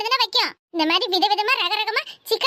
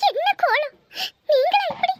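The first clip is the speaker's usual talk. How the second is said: mock crying lament; 6 semitones higher than usual. Mock lament over sister-in-law's state.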